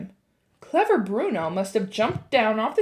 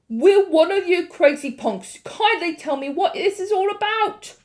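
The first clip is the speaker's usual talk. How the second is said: put-on higher, louder angry boy; Bruno shouting; 8 semitones higher, 5 dB louder than usual. A narrator's reading. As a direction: yell at the crazy crowd. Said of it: "shouted Bruno".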